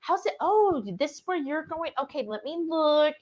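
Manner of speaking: put-on high, babying voice to little jars; talking to ferments. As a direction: coo at your ferments like pets. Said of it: "I talk to my ferments".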